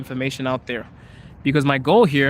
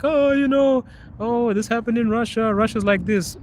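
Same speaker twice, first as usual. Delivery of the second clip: high mocking whine of the critics; 8 semitones higher than usual. Scornful creator mocking people who call Russia bad. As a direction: mimic people trashing Russia, pitched high. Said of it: "this rubbish idea of like".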